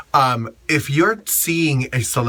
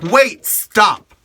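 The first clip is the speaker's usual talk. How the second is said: higher, louder challenge; raised-voice snap at a pushy fan; sharp retold confrontation; 7 semitones higher, 4 dB louder than usual. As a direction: call him out loud and sharp. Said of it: "And I'm like".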